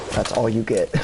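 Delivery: ordinary talk, nothing marked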